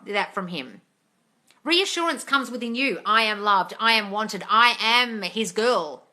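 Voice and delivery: high, loud preaching to the viewers; passionate rant. Real, not acted. Coach lecturing viewers about reassurance, loud impassioned rant. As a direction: hammer the point home, fired up.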